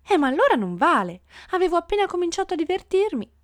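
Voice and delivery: high, quickening complaint; whining protest. A narrator's reading. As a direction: protest like a lady whose fun is cut short. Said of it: "che protestava".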